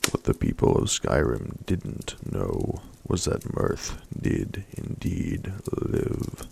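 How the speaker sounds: usual speaking voice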